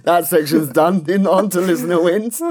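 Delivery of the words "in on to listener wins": ordinary delivery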